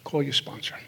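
low, soft guiding voice; gently nudge, hushed and low; quiet inner voice. Acted. Godly voice giving guidance at the kitchen table, quiet. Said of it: "this is the voice that cuts through".

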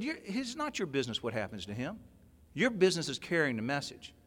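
quiet correction. Retold or imitated. Old timer setting him straight.

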